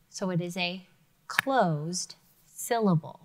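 normal talking voice

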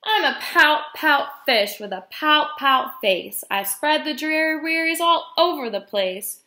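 bouncy, rhyming storybook delivery; reading to children. A narrator's reading. Guest reader voicing jellyfish and glum fish, animated storytime read. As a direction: swing the pitch through each rhymed exchange.

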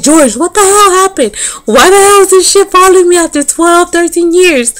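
high, disbelieving rant to a friend; exasperated outburst. Retold or imitated. Calling her homeboy about the ex, an exasperated high outburst. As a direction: throw up your hands in disbelief.

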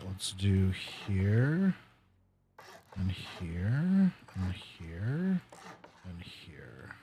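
slow, fading whispered count; hushed whisper. Real, not acted. Placing blocks, hushed.

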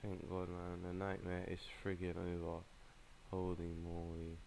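soft, low, spent murmur; drained relief. Real, not acted. Player glad the nightmare is over, drained. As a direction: let the tension go, softly and low. Relief after a hard match.

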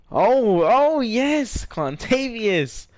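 sudden high, loud "oh, yes"; thrilled yelp of delight; excited cheer. Real, not acted. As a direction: burst out cheering at the screen. Excited gamer cheering a moment.

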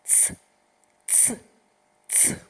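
"ц" as a short voiceless hiss through the teeth; repeated crisp "ц" sound; sound demo. Shown as a demonstration. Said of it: "Ще раз".

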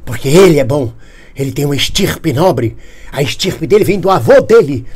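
ordinary talk, nothing marked

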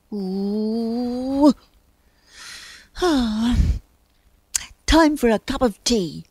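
a hint of a bear thinking to himself; lightly voiced bear. A narrator's reading. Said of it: "thought Rufus".

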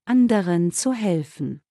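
ordinary delivery

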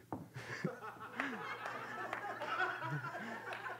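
light laugh at his own joke; quick amused laugh; high chuckle. Real, not acted. Preacher laughing at the imagined worried Jesus.

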